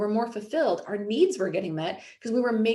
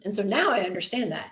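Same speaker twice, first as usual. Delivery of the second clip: ordinary talk, nothing marked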